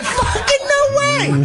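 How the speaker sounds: very high, drawn-out disbelief; mock astonishment. Teen learns he is normal, high drawn-out astonishment. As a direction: stretch it into a high amazed squeal. Amazed teen at relieving news.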